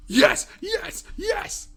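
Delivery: high squealed yes; excited cheering. Real, not acted. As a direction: cheer with delight. Cheering on screen.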